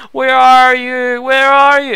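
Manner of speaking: slow, high, wailing cat voice; cat crying. Retold or imitated. Voicing the cat calling for his owners, high and wailing. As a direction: cry it out like a lost cat. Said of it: "pretty much a cat crying".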